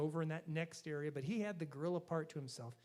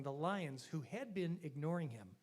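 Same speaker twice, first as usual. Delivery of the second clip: normal talking voice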